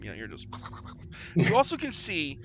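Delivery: ordinary talk, nothing marked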